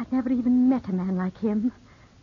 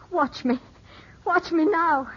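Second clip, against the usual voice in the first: building, each watch me higher and more intense; defiant challenge; 9 semitones higher than usual. Acted. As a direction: dare him, pitch climbing with every repeat. Rachel offers herself defiantly.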